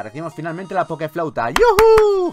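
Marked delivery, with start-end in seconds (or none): 1.5-2.3 high, loud whoop; excited cheer. Real, not acted. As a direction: whoop in triumph. Cheers on getting the item.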